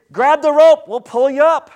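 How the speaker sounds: high, loud rescuer's call. Acted. Rescuer shouts to someone in the pit, calling out loudly. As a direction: call it down to someone stuck below.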